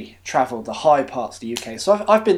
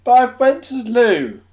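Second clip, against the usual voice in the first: put-on higher, louder childish whine; whiny pupil; 8 semitones higher, 4 dB louder than usual.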